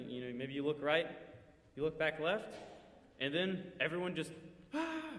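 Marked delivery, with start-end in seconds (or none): 4.7-5.1 mock scream. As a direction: let out a thin, held-back charging yell. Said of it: "screams and charges".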